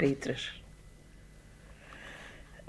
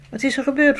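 surprised; 8 semitones higher, 4 dB louder, much faster than usual. Retold or imitated. Asking the crowd outside.

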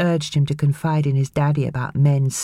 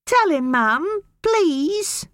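put-on high, pleading boy near tears; appealing tearfully; much slower than usual. A narrator's reading. Boy begging his mother, high and tearful. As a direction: beg your mother to back you. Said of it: "he appealed to his mother".

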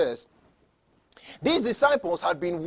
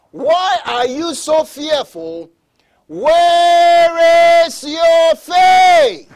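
loud, high, drawn-out preacher's shout; booming challenge from the pulpit, very slow; shouted rebuke; 8 semitones higher, 10 dB louder, much slower than usual. Acted. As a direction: thunder the question, stretching every word. Preacher thunders Jesus's rebuke.

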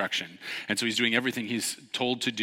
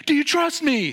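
high loud question; urgent challenge; 7 dB louder than usual. Retold or imitated. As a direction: demand his trust. Instructor confronting swimmer.

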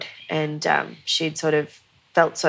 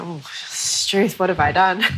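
mock dismay. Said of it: "was like".

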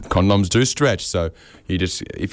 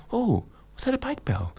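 light, soft, high pedestrian voice; gently surprised, sweet; pleasant pedestrian; 7 dB quieter than usual. Retold or imitated. Walkers hearing his little ding, softly sweet. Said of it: "and they go".

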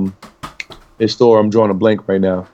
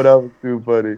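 usual speaking voice

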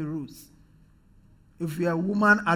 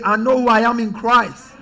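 loud rallying declaration; emphatic prompt; 7 dB louder than usual. Shown as a demonstration. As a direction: declare it firmly for the congregation to echo. Insists congregation say it with force.

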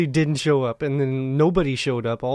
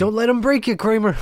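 high, louder mock encouragement; hammed-up rallying line; mock pep talk; 7 semitones higher, 4 dB louder than usual. Joking movie-style encouragement.

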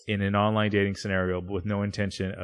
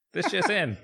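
put-on old-timey announcer; 10 semitones higher than usual. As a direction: announce the scandal like vintage radio. Newsreel announcement.

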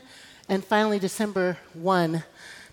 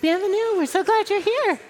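standup comic crowd work; 11 semitones higher than usual. Real, not acted. Welcoming visitors from the crowd.

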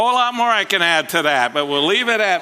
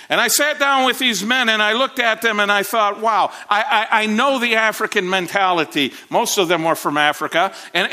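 raised, animated preacher's telling; preaching; 4 semitones higher than usual. Acted. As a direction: lift the story for the congregation. Preacher building up the story.

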